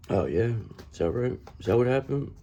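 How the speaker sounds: ordinary delivery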